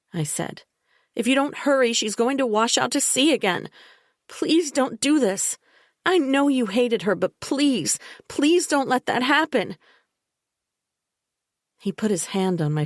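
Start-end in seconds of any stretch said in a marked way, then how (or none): 1.2-9.8 higher, urgent pleading to be believed; desperate pleading. A narrator's reading. The narrator begging Bella and Jake to get Dad to the shore, desperate. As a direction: beg them before it gets dark.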